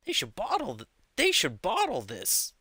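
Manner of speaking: high, swooping mock amazement; playful burst of wonder; retold excitement. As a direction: gush like an impressed kid. Acting out childhood excitement.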